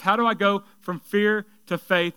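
normal talking voice

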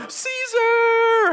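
very high drawn-out call, slow; exalting cry. Acted. Workers on Monday call out, slow very high praise. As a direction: stretch the name out in praise.

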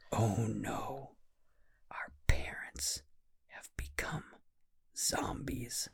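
hushed, breathy whisper of alarm; scared whisper. Acted. Kids whispering to each other, spooked by the parents. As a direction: whisper like the monsters might hear.

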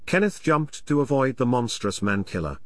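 usual speaking voice

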